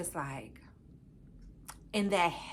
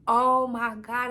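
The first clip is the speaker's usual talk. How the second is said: loud, drawn-out, higher-pitched elated cry; happy and excited; 4 semitones higher, 9 dB louder, slower than usual. Retold or imitated. Man hearing his proposal accepted, happy and excited. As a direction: imitate his overjoyed reaction, slow and loud. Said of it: "he was just so happy and excited".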